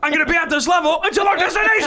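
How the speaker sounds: very high, fast, laughing rant; mock Louis rage. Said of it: "He loses".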